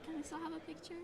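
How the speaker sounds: very soft, slightly high asking voice; timid mock request. Opponent asking the player they just beat, meek and hushed. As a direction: ask it small and sheepishly.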